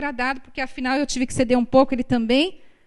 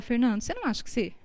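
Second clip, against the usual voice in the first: gentle private word; 3 semitones lower, 7 dB quieter, much faster than usual. Retold or imitated. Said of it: "aí eu falo".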